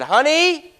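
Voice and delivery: high, loud burst on honey; excited call to wife. Retold or imitated. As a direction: grab her attention in triumph.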